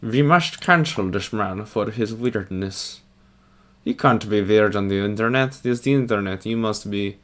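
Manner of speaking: put-on Russian accent; mock Russian accent. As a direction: scold in a hammed-up Russian accent. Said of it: "I turned Russian for a quick second".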